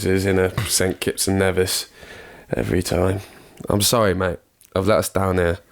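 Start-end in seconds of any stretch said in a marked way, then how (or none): none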